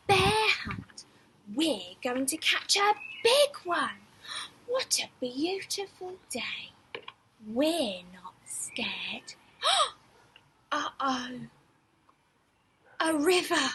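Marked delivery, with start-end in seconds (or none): 4.7-6.7 soft whispered cheer. A narrator's reading. Bright refrain, whispered here. 7.5-9.3 slow breathy whisper, pretending to be brave. A narrator's reading. Refrain whispered in storytelling.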